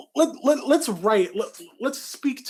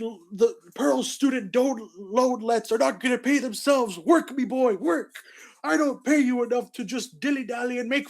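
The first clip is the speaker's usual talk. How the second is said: Mr. Krabs; 6 semitones higher than usual. Acted. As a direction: boss his worker around in a high pirate voice. Mr. Krabs scolding SpongeBob.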